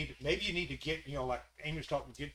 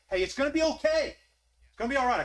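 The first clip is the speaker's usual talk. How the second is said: higher, louder comforting reassurance; voicing Jesus reassuring Martha, raised and loud; Jesus consoling; 9 semitones higher, 7 dB louder than usual. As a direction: call out to comfort a grieving sister. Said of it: "console him, say".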